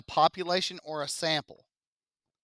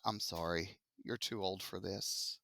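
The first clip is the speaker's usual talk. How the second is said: much softer, lower acted apology; apologetic rejection; 8 semitones lower, 12 dB quieter than usual. Surveyor turning away a too-old respondent, quietly apologetic. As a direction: let them down quietly, as an imagined surveyor.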